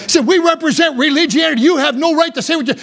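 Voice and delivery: a touch of Pharisee indignation; indignant Pharisees. Acted. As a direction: lightly act out an affronted leader pulling rank. Acts out Pharisees rebuking again.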